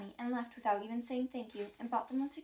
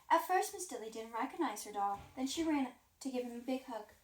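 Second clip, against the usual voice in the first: usual speaking voice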